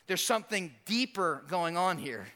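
normal talking voice

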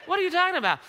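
hammed-up incredulous comeback; flustered denial. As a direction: protest as if wrongly accused. Peter's flustered denial, acted.

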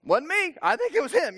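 high-pitched mock innocence; nervous culprit. Preacher imagining himself caught by Jesus, mock innocent. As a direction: pass the blame in a high voice. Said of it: "I'd be like".

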